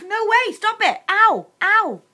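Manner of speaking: high, loud, frantic cries to stop; begging for the pain to end; real pained pleading. Real, not acted. Wife begs husband to stop pressing, high frantic pleading.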